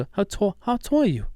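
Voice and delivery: high-pitched impression of an actor; Mark Wahlberg. Host imitating a TikTok comic's Wahlberg, high-pitched impression. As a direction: mimic the clip's high voice, stretching the words. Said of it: "the way he says".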